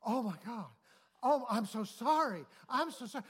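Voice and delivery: loud, high, horrified gushing; imitated over-the-top remorseful outburst; mock frantic apology. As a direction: act out someone mortified and apologizing profusely. Acting out the woman's horrified apology.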